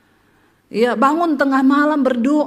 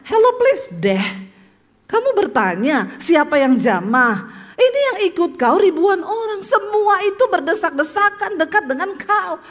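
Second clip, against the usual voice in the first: put-on higher, sassy trendy teen voice; Jaksel girl; 7 semitones higher than usual. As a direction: scoff like a spoiled South Jakarta kid. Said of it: "Gaya-gaya anak Jaksel".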